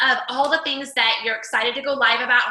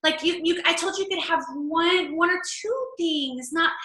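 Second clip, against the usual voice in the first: higher, mock-stern scolding of the kids; exasperated scolding; 5 semitones higher than usual. Mom scolding kids grabbing everything at grocery store, exasperated.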